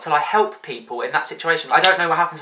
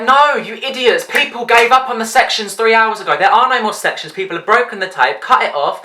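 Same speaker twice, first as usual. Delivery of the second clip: louder, higher, slowed at first; exasperated retort; 6 semitones higher, 4 dB louder than usual. Retold or imitated. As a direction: tell them off in disbelief. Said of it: "And I'm just like".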